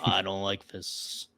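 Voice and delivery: low, slow mock disapproval; James Earl Jones. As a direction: imitate a deep-voiced star, slowly. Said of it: "is James Earl Jones gonna watch this".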